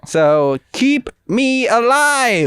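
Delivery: high, slow, stretched-out cry; hammed-up plea. Begging listeners to subscribe, hammed-up plea. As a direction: wail it out, holding the last word.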